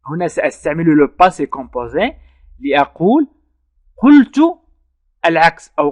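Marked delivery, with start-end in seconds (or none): 4.0-5.7 "قلت" said much higher and louder, then "العكس" drops back; emphatic "قلت", teacher pointing out the tense; stress on the verb. Shown as a demonstration. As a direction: punch "قلت" hard to mark the past tense.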